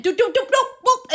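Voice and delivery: rising high, loud doop-boop impacts; imitated thumps. Imitates bumps of thrown fight.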